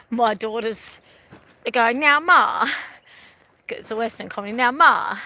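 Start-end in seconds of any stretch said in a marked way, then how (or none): 2.0-2.7 imitating a Western country accent, higher and louder; Western drawl. Daughters rehearsing a Western comedy, mock Western accent. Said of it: "really in their Western dialogue". 4.5-5.2 light imitated Western accent, a bit louder; a hint of the daughters' Western twang; Western drawl. Daughters playing in a Western comedy, light Western accent. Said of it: "really in their Western dialogue".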